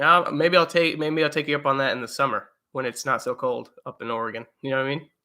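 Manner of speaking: ordinary delivery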